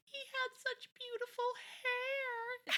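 high, drawn-out gushing; mock-tearful high coo, stretched out; gushing quote. Podcaster quoting Bree admiring Roger's haircut, gushing. Acts Brianna's tender line from show.

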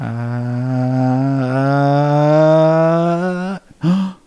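long rising frightened gasp; drawn-out loud gasp. Retold or imitated. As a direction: gasp as the terror returns. Vision returns, gasps.

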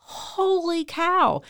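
long, high-pitched "holy cow"; wowed and drawn out; amazed exclamation. Real, not acted. Amazed exclamation about a show.

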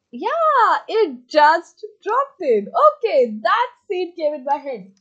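high, loud burst of glee; excited. Real, not acted. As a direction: blurt it out thrilled.

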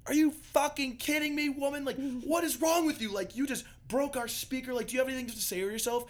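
high mock yell at a woman; retelling a friend screaming at a party guest, high mock yell; imitating Rob screaming. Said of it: "And Rob starts screaming at her".

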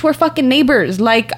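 ordinary talk, nothing marked

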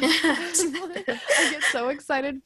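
slow, loud, laughing gush; laughing excitedly. Real, not acted. Laughs after cheering friend.

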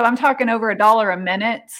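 normal talking voice

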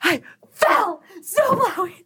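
high, slow mock wailing; mom's wail. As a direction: whine it like a distraught mom crying out. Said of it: "And she goes".

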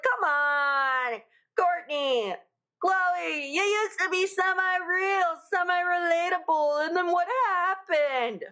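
high pleading mock voice; mock plea. Pleading with the Kardashian sisters in a high mock voice. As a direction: whine it up at them.

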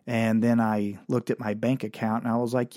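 ordinary delivery

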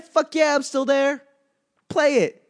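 high, loud, hammed-up eagerness; bursting to binge the next episode; mock eager yes. Answering the autoplay prompt, hammed-up eager and high.

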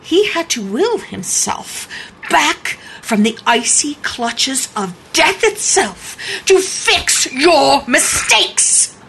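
hammed-up doom, slow and emphatic; mock melodrama. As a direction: draw it out with grand, ominous weight. Mock-dramatic resurrection speech, then kidding.

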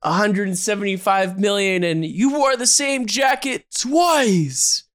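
high, slow incredulous online heckler; mocking internet commenter. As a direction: sneer it like a snarky fan comment. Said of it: "the comments will be like".

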